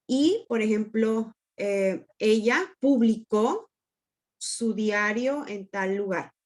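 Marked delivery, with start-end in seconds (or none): 2.8-3.7 pu-bli-CÓ, slow with final syllable stressed; stress on last syllable. Shown as a demonstration. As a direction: land on the final CÓ of "publicó". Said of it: "con diferente, eh, acentuación".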